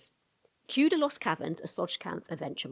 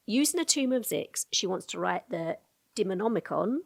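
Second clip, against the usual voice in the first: normal talking voice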